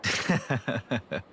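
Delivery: light laugh at a friend's mishap; amused chuckle. Acted. Chuckles on hearing he got a scolding, amused.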